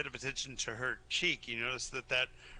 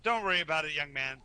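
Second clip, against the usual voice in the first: dwarf booms it out cheerfully; loud friendly reassurance, a pat on the knee; reassuring, louder; 5 dB louder, faster than usual. Acted.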